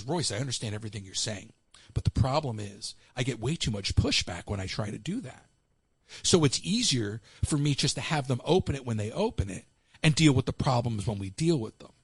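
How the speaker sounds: a hint of the boss letting it slide; lightly voiced boss. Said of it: "And he goes".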